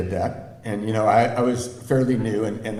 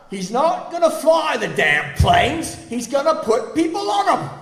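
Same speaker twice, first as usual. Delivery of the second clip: retold shout; 13 semitones higher, 6 dB louder than usual. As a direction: bellow it through the wall, fed up. Boss shouting through the wall.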